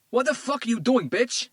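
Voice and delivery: put-on man yelling at his girlfriend; furious yell. A narrator's reading. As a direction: scream it at her. Tito yells at woman.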